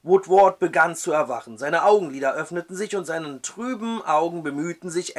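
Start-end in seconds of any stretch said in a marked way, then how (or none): none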